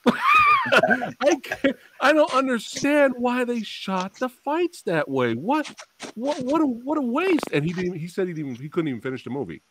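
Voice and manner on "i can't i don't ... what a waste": high-pitched exasperated rant, slower; excited ranting friend. Retold or imitated. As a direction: whine it out in disbelief at the waste. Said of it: "I never heard him this excited".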